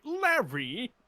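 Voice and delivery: "Larry!" with a high rising call; Larry inflection. Shown as a demonstration. Player demonstrating his odd pronunciation, rising call. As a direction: pitch up sharply on "Larry!". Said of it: "inflection specifically".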